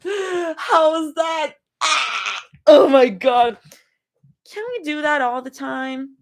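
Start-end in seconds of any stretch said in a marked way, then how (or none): 0.0-3.6 gasping, high and slow in wonder; breathless wonder at the view; awed gasp. Acted. Girl gasping in awe at her first sunset. Zoe gasps seeing the sunset.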